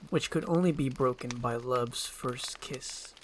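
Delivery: usual speaking voice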